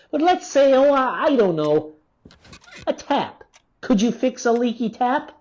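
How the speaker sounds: sarcastic taunting. Acted. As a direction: bait her with fake curiosity. Taunting the doctor about handiwork.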